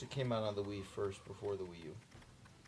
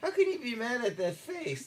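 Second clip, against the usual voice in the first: very high, loud, squealing with laughter; squealing gush; 13 dB louder than usual. Real, not acted. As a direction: squeal over an adorable face. Gushing over cute character.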